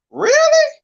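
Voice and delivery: very high, very loud incredulous shriek; shrieking disbelief. Real, not acted. Cohost shrieking in disbelief about the author. Host reacts in shock again.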